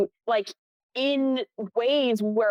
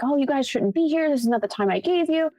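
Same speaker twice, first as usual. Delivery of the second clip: mock telling-off, pitched higher; higher-pitched, mild mock yell; retold yelling; 6 semitones higher than usual. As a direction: imitate an irate owner's yell, only half-raised. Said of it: "yelling at the whole crew".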